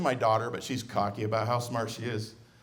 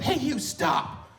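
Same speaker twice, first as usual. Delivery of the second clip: higher, slow mock yell of a mother; 13 semitones higher, much slower than usual. Tired mother yelling at fleeing dad, mock yell. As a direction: call him to halt.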